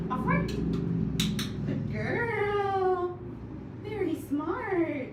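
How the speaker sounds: high sing-song dog praise. Real, not acted. Trainer coaxing her dog Chloe onto the stool, high excited praise. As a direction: cheer the dog on in a bright pet voice.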